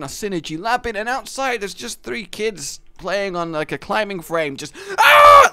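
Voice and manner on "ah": sudden high piercing scream; kid scream. Retold or imitated. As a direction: shriek like a kid on a climbing frame. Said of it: "It literally sounds like murder".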